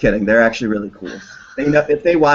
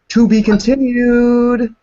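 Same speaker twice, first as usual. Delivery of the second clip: high, slow, louder trailer voice; mock announcer; 10 semitones higher, 5 dB louder, slower than usual. Signing off the livestream, slow dramatic announcer voice. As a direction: drag it out like a TV cliffhanger.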